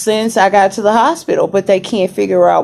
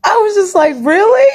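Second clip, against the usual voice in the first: laughing; faster than usual. Real, not acted. Laughing at the view.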